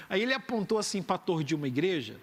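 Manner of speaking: ordinary talk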